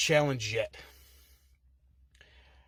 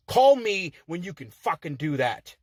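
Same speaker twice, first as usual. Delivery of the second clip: normal talking voice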